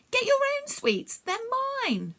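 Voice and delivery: put-on small boy protesting to the bullies in a high voice. A narrator's reading. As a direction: stand up for your sweets. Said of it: "protested the small boy".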